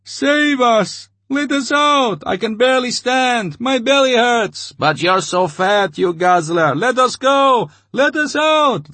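starving crowd pleading and jeering at the major; babbling crowd. A narrator's reading. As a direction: beg and jeer like a starving mob. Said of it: "the crowd said in a babble of voices".

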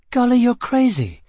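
very high, loud protest; incredulous woman. A narrator's reading. Prisoner objects to his escape route, incredulous.

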